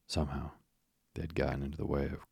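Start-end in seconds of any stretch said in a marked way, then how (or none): none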